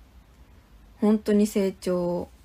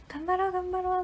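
high cheering voice; 10 semitones higher, 4 dB quieter than usual. Retold or imitated. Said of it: "って".

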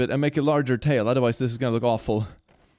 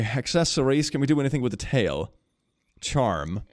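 normal talking voice

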